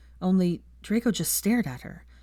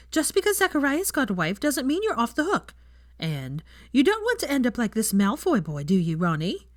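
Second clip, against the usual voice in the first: mocking the mother; 6 semitones higher than usual. Mocking her nagging mother.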